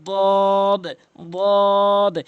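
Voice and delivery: দদ as a heavy dood, vowel held long and loud; three-alif stretch. Shown as a demonstration. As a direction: stretch দদ slowly for three counts. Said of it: "তিন আলিফ করে টেনে টেনে".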